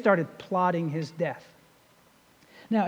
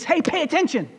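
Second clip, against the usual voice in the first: higher, urgent call to listen; attention-grabbing call; 7 semitones higher, faster than usual. Acted. Preacher explaining "truly, truly" to congregation, calling for attention. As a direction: call out to make them listen. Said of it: "you know what that means".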